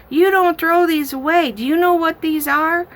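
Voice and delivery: put-on insistent little girl. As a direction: scold grandma like a know-it-all child.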